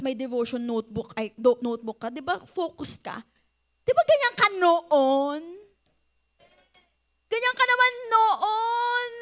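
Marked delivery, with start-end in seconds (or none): 3.9-9.1 teasing. Real, not acted. Teasing the audience about the past.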